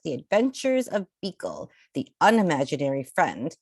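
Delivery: usual speaking voice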